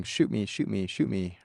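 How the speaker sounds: ordinary talk, nothing marked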